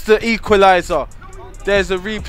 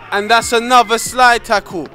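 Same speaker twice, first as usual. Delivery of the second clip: shouted commentary, much higher; excited shout; 6 semitones higher, 8 dB louder than usual. Real, not acted. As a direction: yell it like a live commentator. Excited football commentary over footage.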